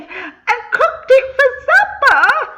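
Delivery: hammed-up laughing telling of the wicked deed. A narrator's reading. Narrator laughing at wicked stepmother.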